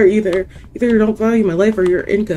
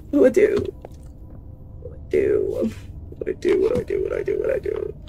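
hushed panicked murmur; scared whisper while hiding; breathless whisper; frightened whisper; 6 dB quieter, faster than usual. Real, not acted.

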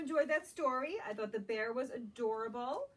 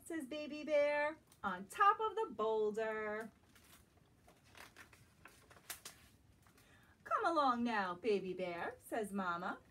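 gentle, lilting storybook read-aloud; reading to children; 3 semitones higher than usual. Acted. Teacher reading a picture book to young children, lilting. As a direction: draw little listeners into the story.

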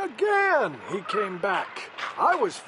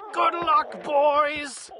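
higher, calling out a farewell; cried out; 7 semitones higher, much slower than usual. Acted. Lorax calling goodbye to the Barbaloots. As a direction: call it after them as they go. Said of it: "he cried".

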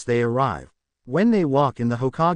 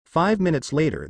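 usual speaking voice

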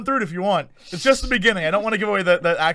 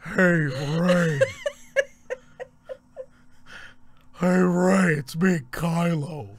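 lightly ghostly villain, drawn out; a hint of a spooky put-on greeting; Kylo Ren; slower than usual. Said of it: "your Kylo voice is very similar to your ghost voice".

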